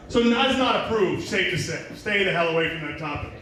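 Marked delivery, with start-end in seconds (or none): none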